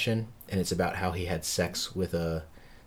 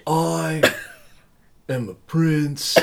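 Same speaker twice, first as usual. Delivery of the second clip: slow, higher, hammed-up Keanu doing Shakespeare; mock Keanu Hamlet; 8 semitones higher, 5 dB louder, much slower than usual. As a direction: drag it out like a lost surfer playing Hamlet.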